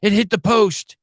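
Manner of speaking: a mock-thrilled reaction; mock suspense. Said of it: "I'm on the edge of my seat".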